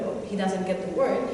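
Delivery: ordinary delivery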